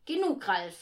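loud, firm cut-off; firm reprimand. A narrator's reading. Mother quiets giggling children at rehearsal, firmly. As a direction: put a stop to it firmly. Mother raps floor, restores order.

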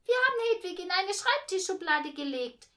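put-on high, loud child voice; brash kid's answer. A narrator's reading. Girl answers mother's question about the baby, brash and loud. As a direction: pipe up loudly with the answer.

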